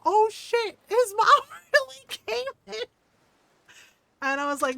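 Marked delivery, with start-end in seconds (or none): 0.1-2.8 very high, loud, cracking up; imitated gleeful shock. Streamer reacting to kid's mom, laughing disbelief. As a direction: squeal it through laughter. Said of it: "And she was like".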